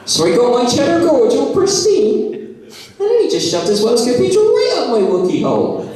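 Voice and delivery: high, put-on mum voice; hammed-up, high-pitched mum impression; imitating someone's mum. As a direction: play a mum telling a saucy tale. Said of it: "euphemisms your mum might use".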